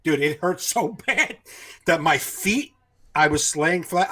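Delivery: ordinary talk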